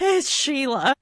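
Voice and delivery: laughing Australian Sheila; Australian accent. Shown as a demonstration. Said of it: "if they're Australian, it's Sheila".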